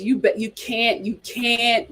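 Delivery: ordinary talk, nothing marked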